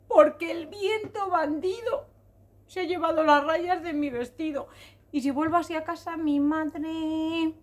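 tearful wail. Acted. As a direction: whine out the complaint through pretend tears. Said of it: "¿por qué lloras así?".

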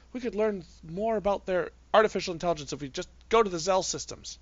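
high-pitched, eager sister voice; excited little sister. Acted. Scolded sister pleading her case to her brother, high and excited. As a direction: protest in a high, rushing sister's voice.

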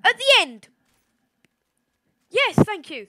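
high loud excited shout. Real, not acted. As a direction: shout it, thrilled. Urging, then relief.

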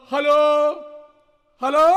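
drawn-out hello, rising at the end; calling hello. Acted. King tries to reach the queen, calling hello. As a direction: call out and wait for an answer.

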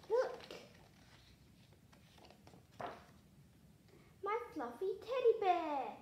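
loud, high-pitched excited showing-off; excited reveal. A narrator's reading. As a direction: announce the find with a big lift. Princess proudly shows her teddy.

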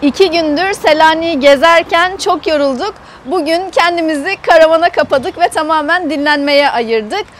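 ordinary talk, nothing marked

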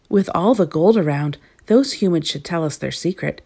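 usual speaking voice

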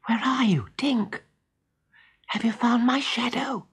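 put-on high, hushed boyish voice; softly calling out for a friend. A narrator's reading. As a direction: search in a light, secretive hush. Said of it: "he called softly".

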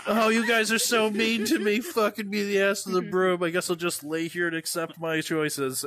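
high, whiny mock sobbing; pretend crying. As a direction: fake being hurt, hammed up. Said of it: "I'm gonna cry and pretend I hate it".

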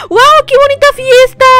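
high, bright wow at the party; delighted wow. Real, not acted. Girl arriving at a neighbour's party, delighted. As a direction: gasp of delight at the pretty party.

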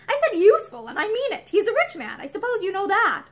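insistent husband. A narrator's reading. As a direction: lean on her, firm and pushy. Husband presses his point.

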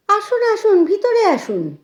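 high, loud, hurried-in welcome; eager welcome. A narrator's reading. Former pupil inviting her old teacher inside, eager.

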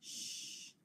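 shh as a soft hiss with no voice; teacher modelling the shh sound. Shown as a demonstration. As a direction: hold a quiet unvoiced shh. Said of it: "which says".

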